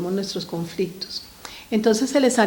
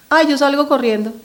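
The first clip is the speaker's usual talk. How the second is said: put-on child's answer; 6 semitones higher, 7 dB louder than usual. Voicing a child's careless answer.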